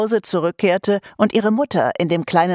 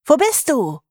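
high, raised call; 9 semitones higher than usual. A narrator's reading. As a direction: search for her, calling out. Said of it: "rief sie".

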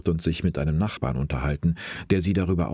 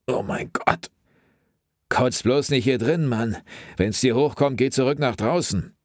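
sharp warning; 8 semitones higher than usual. A narrator's reading. Wilson warning Mike not to throw up inside. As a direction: bark it at the gagging partner. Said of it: "warnte Wilson".

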